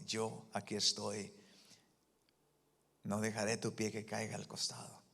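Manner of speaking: grave, suave y pausada; voz de Dios. Retold or imitated. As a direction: habla con calma divina, en voz baja. God's voice speaking to Jack. (Dios le habla a Jack con voz grave y suave)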